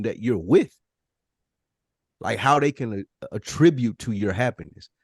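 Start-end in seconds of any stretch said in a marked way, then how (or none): none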